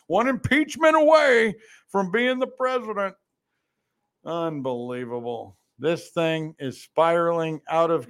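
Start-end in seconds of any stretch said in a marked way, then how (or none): 0.1-3.1 "away" lifted higher and falling; mocking emphasis on "away"; higher punchline. Real, not acted. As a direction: push the repeat up as the punchline. Repeated line, pitched up for punch.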